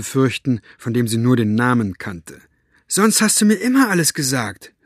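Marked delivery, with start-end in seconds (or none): 2.9-4.7 high-pitched, reproachful call; hurt, indignant girl, voice raised. A narrator's reading. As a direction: call out an accusation to a father. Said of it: "rief sie ihrem Vater zu".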